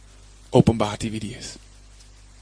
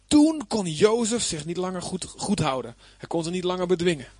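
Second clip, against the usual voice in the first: higher, quicker Bible reading; emotional scripture reading; 7 semitones higher than usual. Acted. Preacher reading Joseph's tearful reveal, higher and quicker. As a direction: read it as the story swells. Reads Joseph's story aloud.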